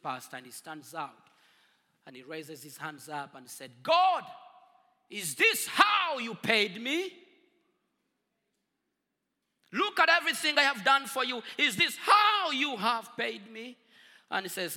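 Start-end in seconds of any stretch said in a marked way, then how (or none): 3.8-13.8 loud, furious cry to heaven; bellowing accusation at God; anguished shout at God. Retold or imitated.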